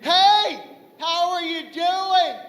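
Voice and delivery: exaggerated high sing-song condescension; patronizing greeter. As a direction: greet them like a helpless little child. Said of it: "don't even greet me properly".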